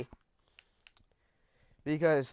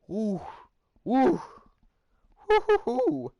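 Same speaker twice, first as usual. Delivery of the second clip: mock hooting; 10 semitones higher, 4 dB louder than usual. Building up Karen's anger.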